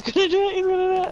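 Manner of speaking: high, eager anticipation; rising thrill as the prank lands; excited. Real, not acted. Gamer watching a teammate pull off the prank, excited.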